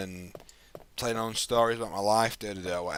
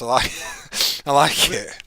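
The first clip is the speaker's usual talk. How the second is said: loud laugh through the words; laughing as he speaks; 3 semitones higher, 9 dB louder than usual. Real, not acted. Amused by friend's phrasing.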